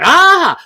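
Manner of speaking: short high startled scream, imitating the officer; startled shriek. Officer shrieks again in retelling.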